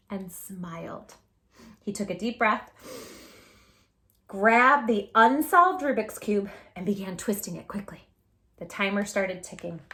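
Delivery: put-on reading a picture book aloud to kids; gentle storytime narration; storybook narration. A narrator's reading. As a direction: tell it like a read-aloud story for children.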